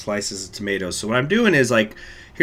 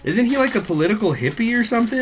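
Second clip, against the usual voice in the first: high, loud disbelieving outburst; incredulous retort; 12 semitones higher, 4 dB louder than usual. Retold or imitated. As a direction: blurt it out in shocked disbelief. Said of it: "I said".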